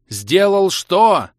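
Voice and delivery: high, loud, drawn-out yell; slow, stretched bellow; screamed retort. A narrator's reading. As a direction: yell back in exasperation. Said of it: "завопил я в ответ".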